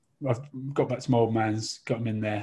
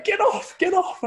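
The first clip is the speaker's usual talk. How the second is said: shooing shout; 4 dB louder than usual. Retold or imitated. Wife shooing him from turkey.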